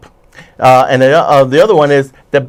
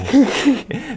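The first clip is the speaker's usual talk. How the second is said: soft high laugh; 11 semitones higher, 6 dB quieter than usual. Real, not acted. Laughs at own story.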